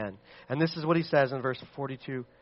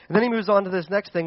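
usual speaking voice

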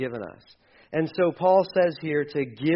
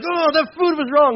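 much higher and louder, exasperated; mock outrage; 12 semitones higher, 9 dB louder than usual. Pastor mocking angry diners, exaggerated outrage. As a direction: huff it like an offended customer. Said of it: "we get mad".

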